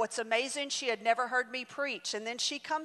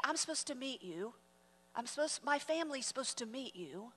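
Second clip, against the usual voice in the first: hushed quote; 10 dB quieter than usual. Retold or imitated.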